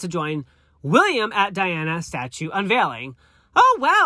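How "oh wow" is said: high, loud surprised gasp; sudden surprise at the news. Real, not acted. Reacting to the Harry headline.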